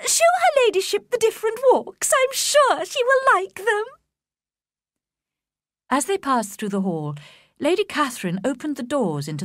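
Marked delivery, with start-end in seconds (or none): 0.1-3.8 much higher, gushing mother's voice; a flustered mother eager to please her ladyship; eager fussy mother. A narrator's reading. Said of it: "cried Missus Bennet".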